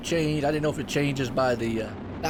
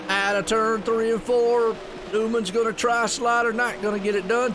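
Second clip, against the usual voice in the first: excited, higher live race call; race play-by-play; 4 semitones higher than usual. Acted. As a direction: call the race lap by lap with energy.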